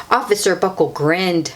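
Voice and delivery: usual speaking voice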